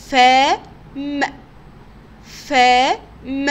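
فم split loudly into long "faa" and short "m"; teacher loudly breaking فم into "faa... m"; spelling out. Shown as a demonstration. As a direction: sound out both parts of فم with a gap. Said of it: "تعالوا نتهجى كده مع بعض".

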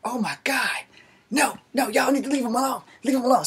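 high, pleading voice, pitched well up; panicked friend pleading. Retold or imitated. Friend begging his buddies to leave him alone, high and pleading. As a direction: beg them to stop, voice up high. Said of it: "the guy ... was like".

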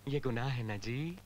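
suddenly low and soft, lifting at the end; softly innocent leading question; mock-meek appeal. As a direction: drop low to coax agreement. Officer coaxes agreement again.

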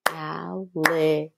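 "Alligator" counted out one syllable at a time. Shown as a demonstration. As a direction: break "Alligator" into al-li-ga-tor, evenly. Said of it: "how many syllables would alligator have".

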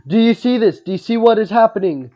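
loud, high alarmed disbelief; what is happening, loudly. Real, not acted. Startled at glitching game.

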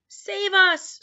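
high, loud cry for help; panicked, high-pitched scream. A narrator's reading. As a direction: scream like a terrified giant. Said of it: "screamed the flesh lump eater".

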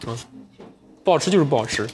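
ordinary talk, nothing marked

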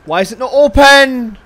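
yelled in real frustration; loud, exasperated outburst; frustrated shout. Real, not acted. Frustrated at locked door.

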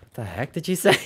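ordinary delivery

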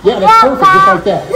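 high, laughing praise. Real, not acted. Laughing praise after the noise.

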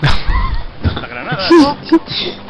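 high burst of real laughter; amused laugh at a friend's remark. Real, not acted. Player amused by teammate's banter, laughing.

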